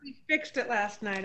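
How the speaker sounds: ordinary talk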